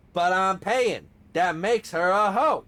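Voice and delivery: loud, high character impression; hammered-out cartoon argument; Riley. Arguing in character for partner's amusement, loud Riley impression. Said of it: "bring out the Riley".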